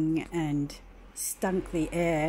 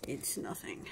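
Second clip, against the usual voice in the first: whispered under the breath, softer; deadpan whisper; 5 semitones lower, 11 dB quieter than usual. Real, not acted. To the viewers after checking the door, dry whisper.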